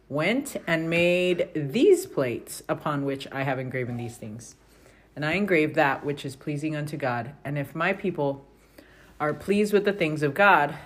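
steady, measured reading of scripture aloud. Acted. Parent reading scripture aloud to the family, measured and even.

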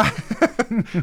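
a real high laugh bursting out; high burst of laughter. Real, not acted. Father laughing at his daughter's blunt answer, a high real laugh. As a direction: laugh out loud, caught off guard.

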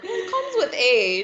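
high-pitched self-mocking quote; mock fifty-something. Woman teasing herself for sounding fifty, high mock-elder voice. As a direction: say it like a worldly elder, hammed up. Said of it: "I make it sound like we're in our fifties".

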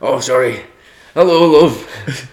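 Paul Scholes impression. As Paul Scholes greeting his daughter home from school, high loud mock voice. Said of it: "Who knew I had such a great Paul Scholes impression?".

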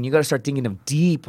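ordinary delivery, nothing marked

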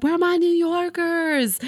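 high, bright stage call to the crowd; diva calling crowd. Retold or imitated. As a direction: hype up the audience like a pop star.

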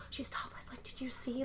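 hushed gossip whisper; breathless whispered gossip. Retold or imitated. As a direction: whisper it so nobody hears. Gossiping about topless woman.

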